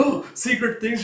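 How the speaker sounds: light up at the thought of secrets; mock thrilled little kid; getting all excited. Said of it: "just getting all excited".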